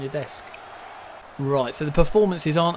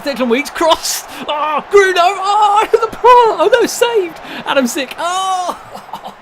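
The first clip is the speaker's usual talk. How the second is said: much higher, much louder, near screaming; excited shouting; 10 dB louder, slower than usual. Real, not acted. Watching a shot get saved, screaming excitedly. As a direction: lose it at the near goal.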